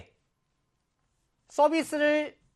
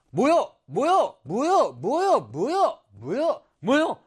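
Chungcheong dialect; 3 semitones higher, 3 dB louder, much slower than usual. Shown as a demonstration. Teacher shows what Chungcheong people say, Chungcheong dialect. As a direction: chant it in a Chungcheong lilt. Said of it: "충청도에서".

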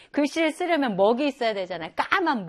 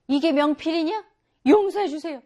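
higher, louder mock exchange; mock scene; 6 semitones higher, 6 dB louder than usual. Shown as a demonstration. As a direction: act both sides briskly. Mother and son voiced. (과장된 대화 연기)

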